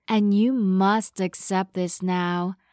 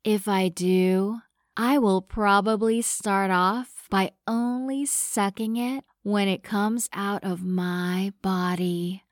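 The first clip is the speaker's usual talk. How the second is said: ordinary talk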